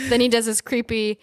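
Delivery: ordinary delivery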